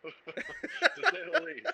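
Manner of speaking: high, slow words through laughter; laughing. Real, not acted. Co-host amused by the shocking claim, laughing. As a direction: laugh the phrase out loudly. Laughing at his own understatement.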